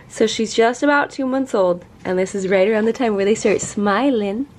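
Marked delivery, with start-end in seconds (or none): none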